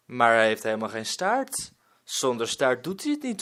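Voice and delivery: ordinary delivery, nothing marked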